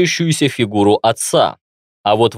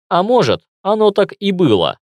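ordinary delivery, nothing marked

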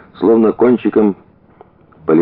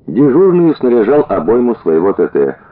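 ordinary delivery

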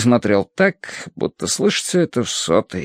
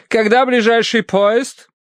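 high, slow matron's question; calling matron; 12 semitones higher than usual. A narrator's reading. Mrs. Mering asks her butler about trains, calling matron. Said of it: "позвала миссис Меринг".

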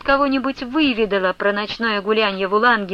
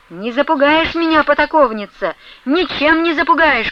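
higher, raised voice of defiance; defiant shouting; 6 semitones higher, 4 dB louder than usual. A narrator's reading. Ustinya defying Flyonushka, shouting back. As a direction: shout back, refusing to be cowed. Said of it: "кричала Устинья".

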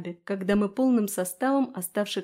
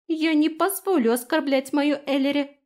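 high, loud defiant little voice; fierce small-fairy protest; belligerent fairy; 6 semitones higher, 6 dB louder than usual. A narrator's reading. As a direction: blurt it out ready for battle. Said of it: "воинственно выпалила Иса".

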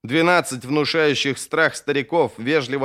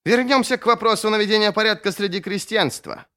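high-pitched, testy interruption; irritated; 9 semitones higher than usual. A narrator's reading. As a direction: cut in with peevish impatience. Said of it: "Раздражённый голос Халлека прервал его речь".

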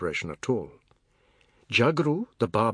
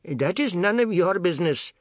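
curt retort; 7 semitones higher, 3 dB louder than usual. A narrator's reading. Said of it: "Raghu said".

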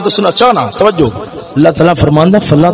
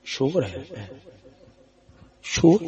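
very soft, hesitant remark; barely voiced, as an old mother; frail mother's murmur; 4 semitones lower, 14 dB quieter, slower than usual. Elderly mother sitting by her son, very quiet.

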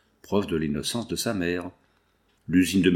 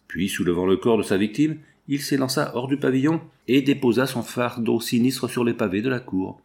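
ordinary delivery, nothing marked

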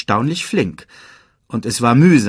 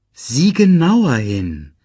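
slow, louder instruction; insistent urging; 4 semitones higher, 5 dB louder, slower than usual. A narrator's reading. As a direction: urge him firmly, drawing it out. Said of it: "forderte ihn Rafiki auf". (langsam und eindringlich auffordern)

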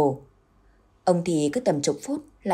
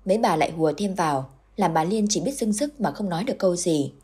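usual speaking voice

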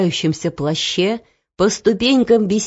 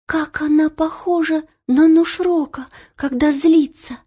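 put-on high girlish voice; girl's alarmed thought; 8 semitones higher than usual. A narrator's reading. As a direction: think aloud, pitched high.

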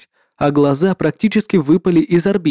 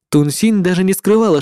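ordinary delivery

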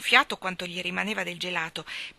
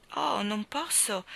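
ordinary delivery, nothing marked

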